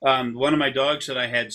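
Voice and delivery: normal talking voice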